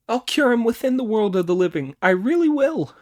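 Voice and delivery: high, loud, fast monkey voice; Monkey's vow. Acted. Monkey eagerly promising the master, high and loud. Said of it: "Monkey vowing to cure king".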